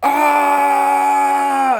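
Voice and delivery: high, loud screamed "Ah!" like a rock singer; imitated rock scream. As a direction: let out a full-throated falsetto rock yell.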